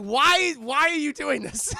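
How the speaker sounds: high, loud wail of shock; hammed-up horrified outburst; mock panicked shout. Monsters shocked at betrayal.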